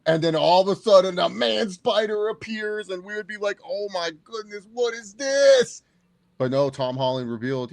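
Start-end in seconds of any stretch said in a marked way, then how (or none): 3.6-5.8 mock shock. Imagined jump scare reaction.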